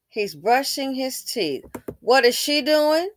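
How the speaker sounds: ordinary talk, nothing marked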